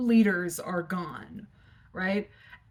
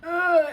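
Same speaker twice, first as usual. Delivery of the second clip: groan; 9 semitones higher, 5 dB louder than usual. Retold or imitated. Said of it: "I was going".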